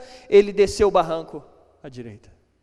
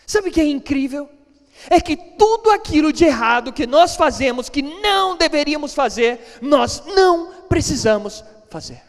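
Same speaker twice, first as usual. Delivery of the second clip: louder, slowing on the last words, hammering the point; preaching at full force; 4 semitones higher, 3 dB louder than usual. Real, not acted. Preacher driving home his point to the congregation, forceful and loud.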